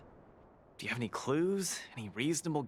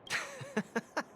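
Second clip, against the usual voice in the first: high chuckling burst; light, high run of chuckles; amused laugh. Acted. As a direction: laugh off the refusal. Laughs off Zila's refusal.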